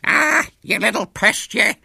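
loud, high, slow screech of rage; cantankerous old woman's fury; enraged. A narrator's reading. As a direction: screech at a hated child. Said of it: "it became distorted with rage".

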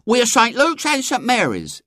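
put-on very high, proud girl; self-important. A narrator's reading. Eglantine explaining to the young man, important. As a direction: announce the group with great importance. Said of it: "explained Eglantine importantly".

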